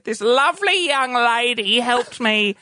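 put-on slow, drawn-out old lady voice; elderly lady. Elderly lady praising helper at the counter, old-lady voice. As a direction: imitate a sweet old customer, slowly. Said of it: "she said".

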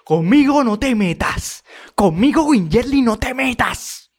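high, loud, drawn-out mock warning; imitated threatening yell. Commentator mimicking Katiuska once she grabs her rival, loud high mock threat. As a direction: holler the warning like a fighter grabbing her rival. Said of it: "Cuando la agarra ahí".

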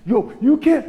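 mock heated snap; mock angry outburst; huffy outburst. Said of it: "getting huffing".

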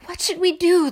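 high, loud panicked question; crying out in fear. A narrator's reading. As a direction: cry out helplessly from the storm. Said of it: "they cried".

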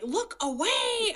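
high, slow strained plea. Retold or imitated. Begging husband not to look, high strained plea. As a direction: draw it out in desperation.

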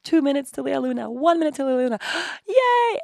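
rising, eager countdown ending in a high yay; bursting with anticipation; excited countdown. Retold or imitated. Mother counting minutes until seeing her baby, excited.

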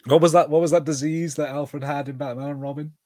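usual speaking voice

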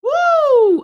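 long, loud, high-pitched "woo"; worship whoop. Real, not acted. Preacher feeling the Holy Ghost, long ecstatic whoop. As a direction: whoop out high as the spirit hits.

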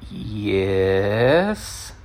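long drawn-out yes, low and rising at the end; hesitant, soft, questioning admission; wary yes. Retold or imitated. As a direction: stretch it out suspiciously. Wary reply to bouncer.

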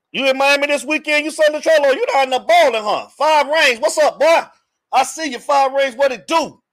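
high-pitched, lively hailing; excited shout-out. Real, not acted. Host hailing a viewer in Miami, excited shout-out. Hails a viewer joining the chat.

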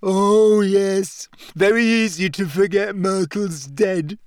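aggrieved, high-pitched moan; tearful gulping. A narrator's reading. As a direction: wail it with wounded self-pity.

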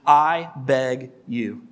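very slow, each word weighted; slow emphatic plea. Shown as a demonstration. Pastor pointing out three powerful words, said slowly. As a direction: lean on all three words I beg you. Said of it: "circle those first three words in this passage".

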